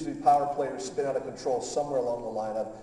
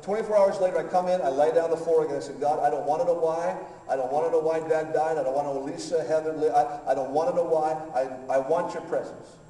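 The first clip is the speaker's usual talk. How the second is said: loud, higher impassioned preaching; preaching at full force; 3 semitones higher, 5 dB louder than usual. Acted. Pastor preaching to the congregation at full force. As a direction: drive it home to the congregation.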